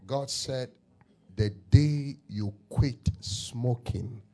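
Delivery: ordinary talk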